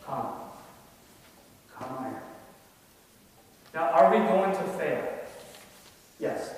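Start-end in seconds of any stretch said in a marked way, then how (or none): 0.1-2.2 soft, much quieter invitation to come; Jesus calling softly, tender and low-key; gentle invitation. Pretend. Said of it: "You know what he's gonna say?".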